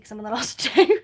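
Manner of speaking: quick excited aside breaking into a laugh. Real, not acted. Crafter apologising to viewers for getting excited, giddy. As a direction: rush it out and laugh near the end. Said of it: "I'm getting excited".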